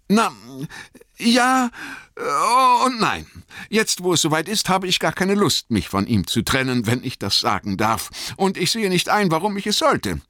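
high hobbit voice, reluctant; hesitate, then refuse in a high-pitched voice; Bilbo, widerwillig. Acted. (widerwillig, mit hoher Stimme)

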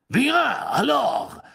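loud, high and imperious; commanding. A narrator's reading. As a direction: bark the command in French.

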